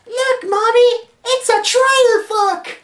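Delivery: put-on very high, slow, loud toddler voice; little child. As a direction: squeal it proudly to Mommy like a tiny kid. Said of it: "since I was a baby".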